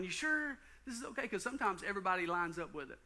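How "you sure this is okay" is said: high, soft worried question; pitched-up anxious second-guessing; doubting inner voice. Retold or imitated. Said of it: "keep going back going".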